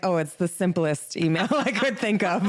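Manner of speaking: quoting herself, breaking into laughter; a sheepish, laughing admission. Retold or imitated. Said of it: "and then I'm like".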